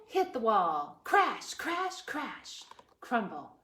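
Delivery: ordinary talk, nothing marked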